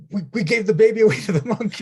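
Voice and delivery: high, loud, laughing through it; laughing dismay. Retold or imitated. Parents admitting they gave the baby away, laughing dismay. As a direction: blurt it out half-laughing. Parents realising their mistake.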